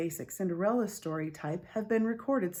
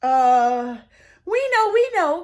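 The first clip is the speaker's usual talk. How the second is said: much higher, loud, desperate bluffing; panicked cry; 9 semitones higher, 13 dB louder, slower than usual. Acted. Sisters crying out desperately to the being's sister. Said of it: "cried those two sisters".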